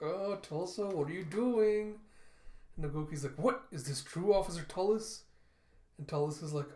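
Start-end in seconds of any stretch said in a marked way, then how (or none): 0.0-2.0 high, slow, drawn-out dismay; dismayed groan. Retold or imitated. Officer exposed by his trainee to her father, dismayed. 3.4-5.2 high, loud startled question; taken aback. Retold or imitated. Father learns of secret combat training, taken aback.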